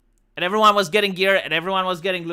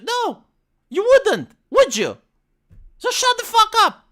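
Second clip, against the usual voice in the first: higher and louder, fed up and exasperated; angry outburst; 12 semitones higher, 4 dB louder than usual. Real, not acted. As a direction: slam the door on the excuse. Ranting at guild members' excuses.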